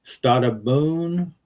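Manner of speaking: slow drawn-out Italian-style goodbye; mock Italian greeting; Italian phrase. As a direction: stretch boon like an Italian. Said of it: "Let's speak, speak Italian".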